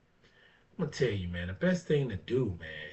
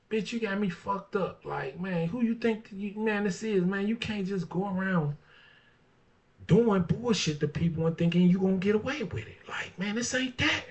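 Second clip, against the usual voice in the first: higher, indignant mock confrontation; talking back to a troublemaker, higher and indignant; pretend telling off; 8 semitones higher than usual. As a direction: tell the fool off, voice raised high. Acting out telling someone off.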